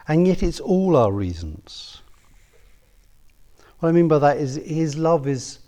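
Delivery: Why love the adulteress, preached high and loud; impassioned preaching. Acted. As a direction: drive it home from the pulpit. Preaching the point with force.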